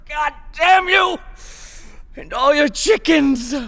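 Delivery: high-pitched furious outburst; exasperated curse. Acted. Prisoner cursing an unmoved guard, high angry outburst. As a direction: curse them at the top of your register.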